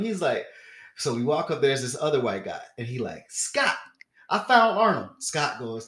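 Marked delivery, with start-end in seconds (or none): 3.3-5.1 loud, high announcement; calling to a friend. Retold or imitated. Stranger telling Scott he found Arnold, calling out. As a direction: imitate hollering news to a buddy.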